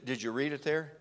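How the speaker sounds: ordinary talk, nothing marked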